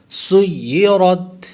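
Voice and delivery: سیرت recited slowly, seen joined to the doubled ya; tajweed recitation. Shown as a demonstration. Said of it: "را پر ہوگا".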